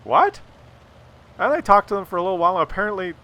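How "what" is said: high, loud incredulous What; mock shocked yelp. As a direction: squawk it in disbelief. Shocked at boys' toenails.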